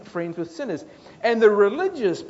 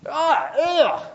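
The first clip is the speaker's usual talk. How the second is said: disgusted groan; 9 semitones higher, 7 dB louder than usual. Acted. Disgust at grumblers.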